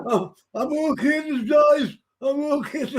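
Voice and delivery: high, strained mock-incapacitated call; drugged-up man. Crawling man insisting to onlookers, high drugged-up impression. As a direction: imitate a man too drugged to function. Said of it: "all the drugs he's been taking kick in".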